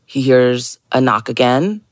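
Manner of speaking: normal talking voice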